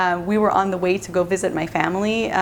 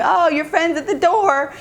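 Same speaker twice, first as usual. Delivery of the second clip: higher voice calling to a sister; calling out, imitated; 7 semitones higher than usual. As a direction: announce a visitor to your sibling, pitched up. Calls out to her sister.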